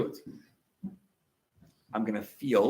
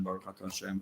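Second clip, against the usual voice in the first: Baruch Atah Hashem almost whispered, much softer; hushed blessing; 6 semitones lower, 13 dB quieter, faster than usual. Shown as a demonstration. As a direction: let Baruch fade to a hush. Repeating blessing as meditation.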